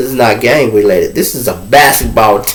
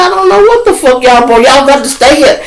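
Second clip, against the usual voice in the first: high, louder exasperation; retold self brushing off his buddies; exasperated retort; 13 semitones higher, 3 dB louder than usual. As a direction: toss it off like it's not your problem. Narrator's own retort to inmates.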